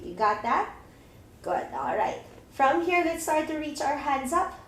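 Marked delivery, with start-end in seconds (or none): none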